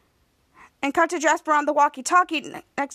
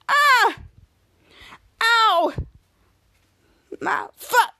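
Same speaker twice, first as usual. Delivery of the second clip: pained yell; 8 semitones higher, 4 dB louder, much slower than usual. Retold or imitated. Ray hurt when the bench moves.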